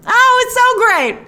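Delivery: high, bright burst of delight; squealing with joy at the line; delighted gush. Real, not acted. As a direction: gush like a thrilled fan. Gushing over a favourite line.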